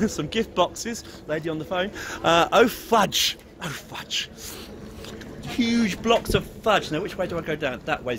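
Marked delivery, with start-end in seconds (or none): none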